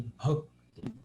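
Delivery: "bhakti" with an audible puff after the B; pronounced H. Shown as a demonstration. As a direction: say "bhakti" as "bhok-ti", aspirated. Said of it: "Tem um H aí". ("bhakti" com o H bem aspirado)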